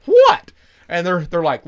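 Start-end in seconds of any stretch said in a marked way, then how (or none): 0.1-0.5 high, loud shocked what; loud high surprised what. Retold or imitated. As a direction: yelp it in disbelief. Retold shocked reaction.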